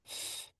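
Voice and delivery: soft airy leaking hiss; flat tire hiss. Shown as a demonstration. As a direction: let air leak like a flat tire. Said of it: "the flat tire is going".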